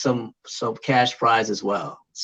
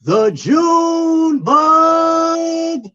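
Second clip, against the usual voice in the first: slow ring announcer, rising high and loud; boxer announcer voice; 7 semitones higher, 4 dB louder, much slower than usual. Pretend. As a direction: build the name into a big high shout. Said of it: "boxer announcer voice".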